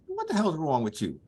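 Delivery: high-pitched, baffled questioning; incredulous demand. Retold or imitated. Storyteller challenging Ali after the fake mumbling, incredulous. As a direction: act out disbelief, pitch leaping up.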